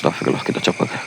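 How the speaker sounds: real frightened whisper; scared whisper. Real, not acted. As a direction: whisper it so nothing hears you. Frightened ghost hunt at night.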